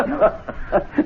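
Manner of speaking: breathy, amused scoff; short unvoiced chuckle; scoffing laugh. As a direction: laugh at their foolishness. Mocking the friends' argument.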